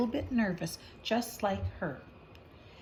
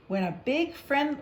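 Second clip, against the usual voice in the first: plain reading voice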